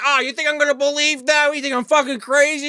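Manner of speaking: high-pitched, incredulous retort; mock disbelief. Retelling a character who refuses to believe the story, mock disbelief.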